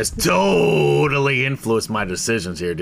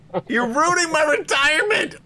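Marge; 14 semitones higher than usual. Retold or imitated. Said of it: "Marge is like".

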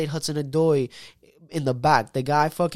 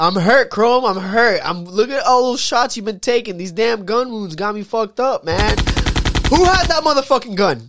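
hammed-up gunshot victim, louder; mock wounded; 6 semitones higher, 6 dB louder than usual. Mock wounded cry after friend's jab. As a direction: play dying from a friend's shots, loud and mock. Playing shot over friend's low score.

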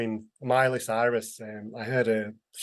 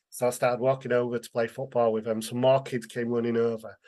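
ordinary talk, nothing marked

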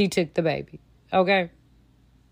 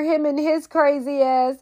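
normal talking voice

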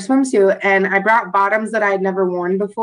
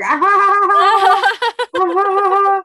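hammed-up mocking ha ha ha, higher; mocking laughter; 8 semitones higher, 3 dB louder than usual. Imitating strangers who laughed at her shirt, mocking cackle. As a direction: imitate the strangers jeering at you. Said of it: "everyone was just like".

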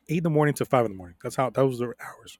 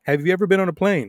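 raised loud voice, higher; calling out; 5 semitones higher, 4 dB louder than usual. Retold or imitated. Confronting old man cutting the plane line, loud. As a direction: call him out loudly. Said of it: "I go".

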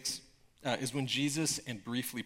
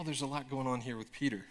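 ordinary delivery

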